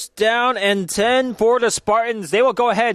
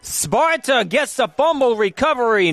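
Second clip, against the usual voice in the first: rising excitement, higher and quicker; excited call; 6 semitones higher than usual. Real, not acted. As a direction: call the fumble as it happens. Fumble recovered live on air.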